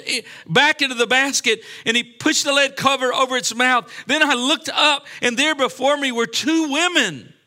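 high, loud dramatic Bible reading; declaimed scripture. Real, not acted. Preacher reads the vision of two winged women, declaimed scripture. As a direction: proclaim the vision with full pulpit force. Scripture read aloud with force.

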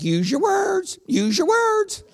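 high, singsong mock-mom coaxing; imitated mom. Mom to her screaming child in the store, high coaxing. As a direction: coax in a high put-on mother's voice. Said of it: "And the mom's like".

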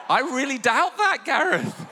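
high-pitched, laughing incredulity; amused disbelief. Real, not acted. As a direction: tease him with squeaky, amused doubt. Joking doubt about the wife's support.

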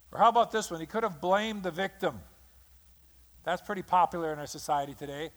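normal talking voice

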